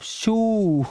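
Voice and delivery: शू with a long, drawn-out oo vowel; शू, one long rounded oo; long oo pronunciation. Shown as a demonstration. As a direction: say शू as "shoo", stretching the vowel. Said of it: "जूते को क्या बोलते हो".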